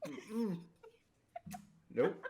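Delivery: high mock refusal hum; high nervous mm-mm, then nope; backing off. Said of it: "They're like".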